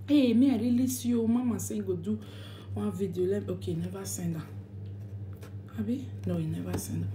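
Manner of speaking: soft, low muttered aside; quiet aside. Real, not acted. Aside about mama's video, hushed and low. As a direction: drop to an undertone.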